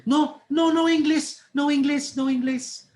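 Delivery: high, loud, repeated brush-off; emphatic mock refusal. Tired passenger pretends no English to Japanese seatmate, loud hammed-up refusal.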